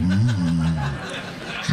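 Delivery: low, sultry hum; deep, suggestive mm; mock seductive wife. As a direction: purr it like a turned-on wife. Wife voiced seductively at the door.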